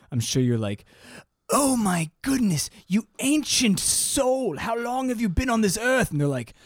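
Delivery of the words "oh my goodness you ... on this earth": mock awe. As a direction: marvel at an ancient elder. Greeting a seventy-two year old.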